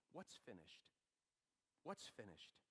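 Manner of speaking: normal talking voice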